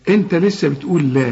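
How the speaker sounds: ordinary delivery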